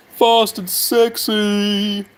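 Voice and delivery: high, long-drawn catchphrase; catchphrase impression. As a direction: stretch it out high, showman-style. Quotes a YouTuber's catchphrase.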